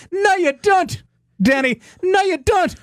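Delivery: high-pitched Irish girl impression; Irish girl accent. Host imitating the Irish-accented girl in Caddyshack, high-pitched Irish impression. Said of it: "I think of the girl in Caddyshack".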